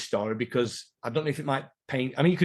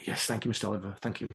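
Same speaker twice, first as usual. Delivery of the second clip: put-on softer, meek young lad thanking the coach; a timid boy saying thank you, much softer; teen thanking his coach, meek and softer; 5 semitones lower, 9 dB quieter, faster than usual. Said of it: "I went".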